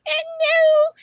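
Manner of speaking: sharp high squeal of no; high denial. Retold or imitated. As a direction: refuse it out loud. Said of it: "I was like".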